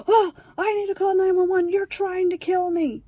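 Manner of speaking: high, loud hammed-up cry for help; gasp, then act out hysterical accusation; mock panic. Mother's staged panic on video.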